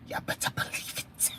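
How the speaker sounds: voiceless whispered repeat; playful whisper. Real, not acted. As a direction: whisper it to the chat, very softly.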